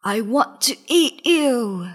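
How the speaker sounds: hungry, menacing lion voice; roaring lion. A narrator's reading. Lion wants to eat the animals, roaring. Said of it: "roared the lion".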